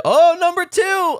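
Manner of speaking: high thrilled squeal; excited. Real, not acted. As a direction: pop with delight. Excited pop for wrestler.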